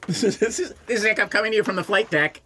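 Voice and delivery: fast pilot radio call; pilot cockpit headset. Retold or imitated. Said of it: "You have to, like, preface everything with".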